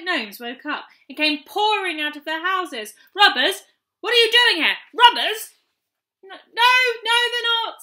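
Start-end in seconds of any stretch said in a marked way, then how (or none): 3.1-5.5 loud, high, suspicious yelling; accusing shout. A narrator's reading. Angry gnomes crowding the children, shouting. 6.2-7.8 high, loud denial; loud protest. A narrator's reading. Chinky defending the children to the gnomes, loud. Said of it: "said Chinky".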